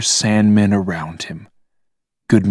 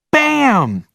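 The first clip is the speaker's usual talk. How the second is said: put-on kid's gun noise shout. A narrator's reading. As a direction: yell it like a toy gun. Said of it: "shouted Logan".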